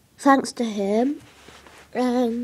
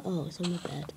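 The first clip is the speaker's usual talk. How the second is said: ordinary talk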